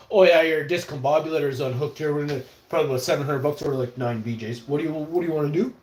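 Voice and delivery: mock mechanic. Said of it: "a mechanic talking to a chick".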